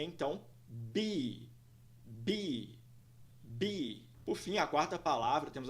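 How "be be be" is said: "be" as one syllable with long EE; teacher's isolated model of "be"; model pronunciation. Shown as a demonstration. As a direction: say "be" slowly, falling, like "bee". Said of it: "Pronúncia dessa palavra é".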